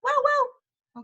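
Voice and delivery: high, playful Spanish dog bark "Wow, wow"; a high, playful imitated dog bark; dog bark imitation. Shown as a demonstration. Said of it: "¿Cómo hace el perro?".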